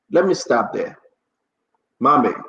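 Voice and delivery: ordinary talk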